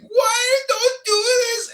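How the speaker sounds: very high, drawn-out mock scream; imitated scream. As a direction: screech it slowly, mimicking the woman. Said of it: "she was screaming".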